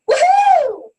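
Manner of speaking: high, loud woohoo of joy; excited cheer. Acted. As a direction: cheer it out like a fan. Announcing surprise guest, cheering.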